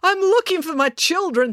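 high, urgent announcement; calling out. A narrator's reading. Dad announces to the crowd he seeks his children, calling out. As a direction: pitch it up over a crowd.

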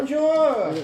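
high, bright-pitched Bonjour; lightly hammed French hello; mock French greeting. Player greeting the French farmer.

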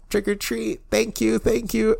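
high, quiet strained voice on held breath; imitating someone with no air left; running out of breath. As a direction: squeeze it out while holding your breath. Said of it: "running out of breath".

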